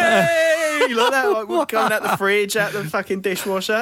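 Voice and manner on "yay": cheering yay. Retold or imitated. Mates jump out cheering.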